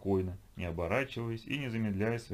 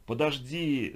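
raised, higher-pitched call; crying out; 5 semitones higher, 4 dB louder, much slower than usual. A narrator's reading. Evgeny calls after the retreating figures, crying out. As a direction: call out helplessly after them. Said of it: "закричал Евгений".

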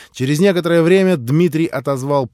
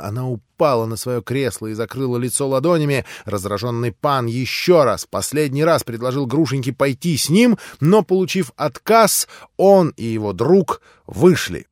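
ordinary delivery

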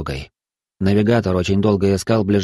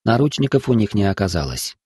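ordinary delivery, nothing marked

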